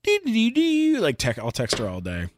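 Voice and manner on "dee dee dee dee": high-pitched "dee dee" babble; high texting noises. Retold or imitated. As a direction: mime nonstop texting with high little sounds. Imitates busy texting while alone.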